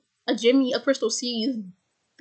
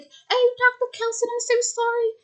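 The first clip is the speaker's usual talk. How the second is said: put-on high, small boy's apology; much higher little boy saying sorry; kid voice; 12 semitones higher than usual. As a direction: voice a sheepish kid. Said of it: "Spike's like".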